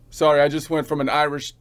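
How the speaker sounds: normal talking voice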